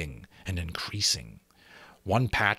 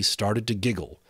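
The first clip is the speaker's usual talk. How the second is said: ordinary delivery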